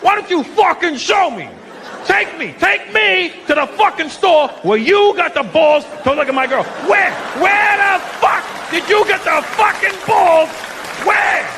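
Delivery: high-pitched, hammed-up furious yelling; escalating mock tirade of a jealous boyfriend; angry interrogation. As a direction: rage slowly, stretching each demand. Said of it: "when they get angry".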